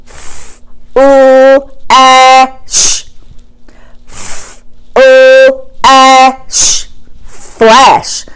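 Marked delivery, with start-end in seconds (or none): 0.1-6.8 f breathed, then u, ash apart; chopped word. Shown as a demonstration. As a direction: chop into sounds. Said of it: "let's do the word".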